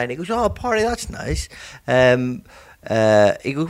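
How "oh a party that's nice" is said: high, cheerful oh; the new farmer, lightly voiced; pleased farmer. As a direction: say it delighted and naive.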